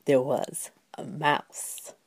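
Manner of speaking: slow, soft storyteller's whisper; hushed reveal. A narrator's reading. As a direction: let it drop to a slow hush.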